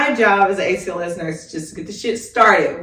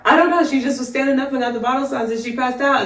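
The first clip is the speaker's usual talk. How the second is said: normal talking voice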